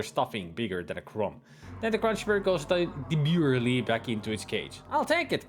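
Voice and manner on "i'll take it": high-pitched eager exclamation, lightly voiced lady; crying out eagerly. A narrator's reading. Lady in pet shop to clerk, crying out eagerly. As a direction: pipe up high, delighted to buy it. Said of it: "cries the lady".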